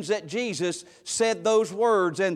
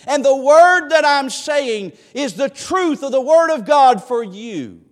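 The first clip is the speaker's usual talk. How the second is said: preaching appeal; 3 semitones higher than usual. Acted. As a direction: press the altar call hard. Rising appeal to the congregation.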